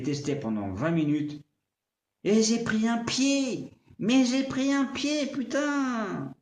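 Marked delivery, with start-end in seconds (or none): 2.2-6.3 high, drawn-out burst of enthusiasm; excited exclamation. Real, not acted. Raving to viewers about the morning's test, excitedly. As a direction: exclaim it high and louder, stretching the words out. Raves about testing the speaker.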